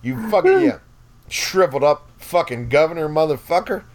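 high, hammed-up outraged squeal; retold squealing tirade at a glaring old actor; mock angry rant. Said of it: "And then I'm looking like".

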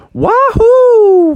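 loud, high wahoo; high-pitched cheer; gleeful whoop. Acted. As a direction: whoop it out like a skateboarder. Skateboarders cheer.

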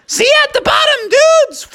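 very high, quick cheery squeal; mama bear calling as she dives. Acted. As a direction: chirp it sweetly before diving. Mama bear jumping off slide.

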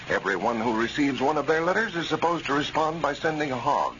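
ordinary talk

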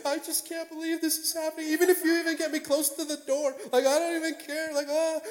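high squeaky crying voice, hammed up; playing up happy tears; mock tearful. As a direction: blubber in a very high voice, overwhelmed. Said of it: "Is he crying?".

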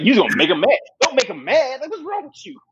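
incredulous, laughing scolding; mock exasperated warning. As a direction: pitch climbing in disbelief.

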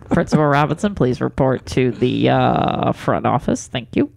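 page him like the front office; school intercom. Pretend. Mock school announcement.

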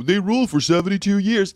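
high, loud mocking gush; mock awe. Host mocking praise of European monarchs, mock awe.

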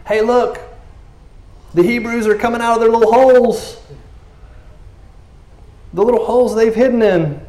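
high, jeering soldier voice; mocking soldiers. Philistines mocking the Hebrews from their post, high jeer. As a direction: sneer it down at them like a guard.

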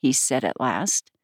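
ordinary delivery

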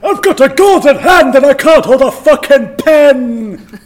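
high, loud, slow mock yell; drawn-out comic outburst of exasperation; Jamie Lannister. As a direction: wail in hammed-up frustration. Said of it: "where Jamie Lannister is writing his Christmas card list".

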